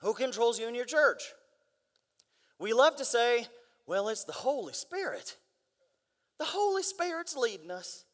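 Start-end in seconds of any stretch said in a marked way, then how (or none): 3.9-8.0 mock Southern churchgoer, softer; dumb Southern accent. Churchgoer claiming who controls the church, mock dumb Southern accent. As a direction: put on a dim Southern accent. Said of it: "I make all the dumb people sound Southern".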